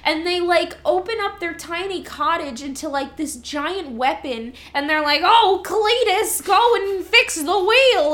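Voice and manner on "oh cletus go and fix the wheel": high, loud, slow hillbilly drawl; quoting a dwarf. Retold or imitated. Retelling dwarves' lines, mock hillbilly order. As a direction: play the bossy dwarf ordering Cletus.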